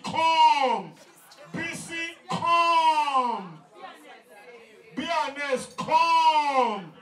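loud, high summoning shout; long drawn-out yell; calling out. Real, not acted. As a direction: holler for someone far off, stretching the last word. Calling women by name to come.

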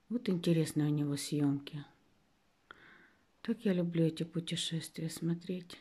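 hushed unvoiced whisper. Real, not acted. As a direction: whisper it so the show stays audible. Whispered remark during the show.

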